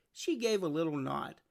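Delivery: ordinary delivery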